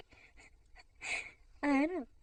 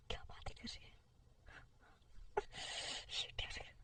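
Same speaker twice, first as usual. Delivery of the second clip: whisper; 9 dB quieter than usual. Acted.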